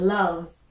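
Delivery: "loves" ending in a clear buzzing z; "loves" with a long voiced z; final z stressed. Shown as a demonstration. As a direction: lean on the z at the end of "loves". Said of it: "z at the end".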